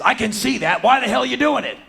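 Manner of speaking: high, very loud demand; exasperated cop shout. Acted. Policeman demands a motive from brawler, exasperated shout. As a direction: yell it at full exasperation.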